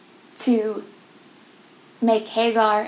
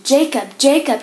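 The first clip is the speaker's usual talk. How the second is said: "Jacob" called twice, raised and higher; calling out; 4 semitones higher, 6 dB louder than usual. Acted. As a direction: call "Jacob" across a distance, twice. God calls Jacob in vision.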